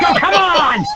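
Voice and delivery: high, laughing mock outrage; exasperated laugh. Wrestler groaning at yet another tag, laughing and high. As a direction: throw it up in laughing disbelief. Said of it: "I'm like".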